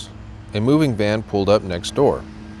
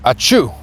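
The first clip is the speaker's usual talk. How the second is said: loud acted sneeze; a big achoo; 3 semitones higher, 4 dB louder than usual. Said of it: "Betty sneezed".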